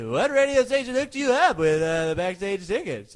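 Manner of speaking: high, loud put-on caller voice; bright fake radio host; imitating a prank caller. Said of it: "I'd say".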